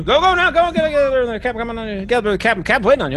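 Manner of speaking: high, rapid hustling voice; shooing urgently. Acted. Steward hurries the pair to the captain, frantic. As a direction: push them out the door. Buzz shooing them along.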